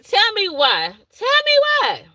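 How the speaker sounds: high, disbelieving tell me why; sudden high leap of outrage; mock disbelief. Real, not acted. Baby stops crying for another teacher.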